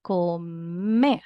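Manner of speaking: "Comer" with a long, strong final "mer"; stress on MER. Shown as a demonstration. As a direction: draw out "Comer", swelling onto "mer". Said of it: "Mer is the strongest part of the word".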